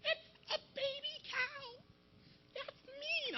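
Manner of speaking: very high, soft mock-girl whine; drawn-out, squeaky guilt trip; nagging vegetarian girl. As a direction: plead slowly in a tiny high voice. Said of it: "This girl, my friend, she's a vegetarian. She's always on me".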